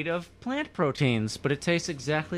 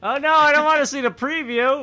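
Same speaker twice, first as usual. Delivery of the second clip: raised, rising cry of no; loud protest; 10 semitones higher, 8 dB louder than usual. Real, not acted. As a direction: call out to stop the spoiler. Avoiding a show preview spoiler.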